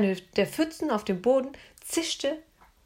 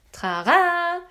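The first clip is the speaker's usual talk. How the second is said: much higher, loud showy "ta-da"; girl unveiling the flashlight; triumphant fanfare; 9 semitones higher, 7 dB louder than usual. A narrator's reading. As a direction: present it like a magic trick. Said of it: "rief sie".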